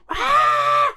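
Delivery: very high loud scream; shrill panicked yell; lockdown scream. Pretend. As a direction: scream in cabin fever. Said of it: "my impression of me during the lockdown".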